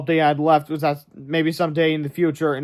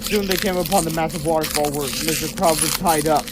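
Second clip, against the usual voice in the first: ordinary delivery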